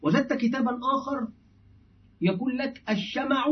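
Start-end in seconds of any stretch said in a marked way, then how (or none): 2.9-3.5 "الشمع" as ash-shama'u, raised in pitch; fatha on the mim. Shown as a demonstration. Teacher quoting another book, "الشمع" with fatha on mim. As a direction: say "الشمع" higher, with a short a after mim. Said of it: "وأن الفصيح هو الشمع".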